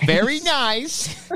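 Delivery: put-on Very nice high and slow in a goofy weird accent. Co-host echoing the bit in a weird accent. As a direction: copy the friend's weird accent, stretched out. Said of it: "in a weird accent".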